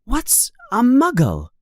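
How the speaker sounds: put-on a boy asking, eager to know; young Harry. A narrator's reading. Harry voiced high.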